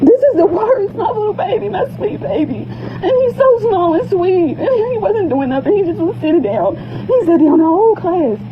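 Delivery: real tears, voice rising and breaking; grieving mother breaking down into tears; crying. Real, not acted. Mother breaks down over abused son.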